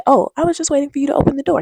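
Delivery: mock-sweet girlfriend reply; innocent girlfriend. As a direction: play her sweet and puzzled. Girlfriend voiced in retold story.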